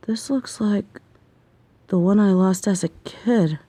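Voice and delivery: ordinary delivery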